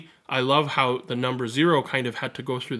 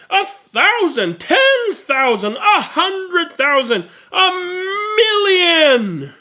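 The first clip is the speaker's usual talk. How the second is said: from level to much higher and louder, climbing to a cry; rising triumphant count to a shouted million; building excitement; 10 dB louder, much slower than usual. Acted.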